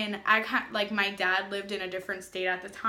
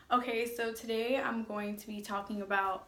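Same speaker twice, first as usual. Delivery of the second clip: ordinary talk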